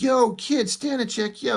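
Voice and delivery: high, hammed-up call to the kid; mock Guido call. The reviewer voicing Guido shouting at the sniper, high mock call. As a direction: yell it up high like a street tough.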